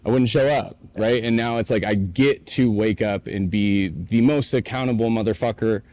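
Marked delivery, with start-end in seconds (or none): none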